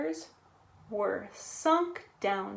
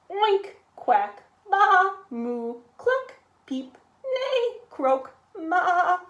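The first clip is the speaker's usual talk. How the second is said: high, louder string of animal calls; a storyteller's barnyard medley; animal chorus; 7 semitones higher, 5 dB louder than usual. A narrator's reading. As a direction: run through each animal's noise. Animals' noisy ride.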